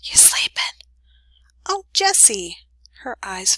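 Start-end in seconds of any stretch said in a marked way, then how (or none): 0.0-0.6 hissed unvoiced whisper; a secret hiss over the fence. A narrator's reading. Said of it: "he hissed". 1.7-2.6 high joyful cry of his name; delighted relief; glad surprise. Acted.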